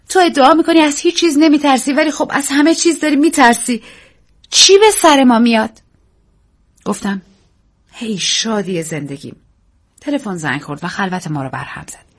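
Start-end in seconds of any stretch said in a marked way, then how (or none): 0.1-5.8 frightened. Acted. Daisy frightened questioning. 7.9-9.4 soft slow endearment; tender. Acted. Tender reply to Daisy.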